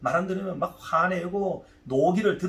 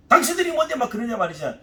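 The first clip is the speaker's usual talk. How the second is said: acted indignant challenge, louder and higher; angry retort; 6 semitones higher, 4 dB louder than usual. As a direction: lash back at them in heated, rapid bursts. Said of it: "세 번째는 막 화를 내는 방법".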